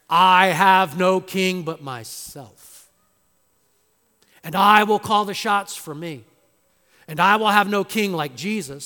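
loud defiant voice of every human heart. Acted. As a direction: voice the self-ruling heart defiantly. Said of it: "this is the cry of every human heart".

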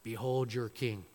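quiet taunt. Acted. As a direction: let it sink in with a quiet sneer. Pilate presents Jesus to crowd.

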